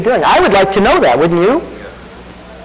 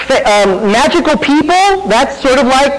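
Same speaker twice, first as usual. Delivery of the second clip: higher voice mimicking a critic; a pastor mimicking critics of fantasy, higher voiced; mock objector; 7 semitones higher than usual. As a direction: parody the simple rule, pitched up. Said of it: "Some Christians don't believe in fantasy".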